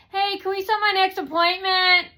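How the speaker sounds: high, loud call across distance; calling from far away. Retold or imitated. Customer calling to stylist for next appointment, calling out. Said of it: "From far away, I said".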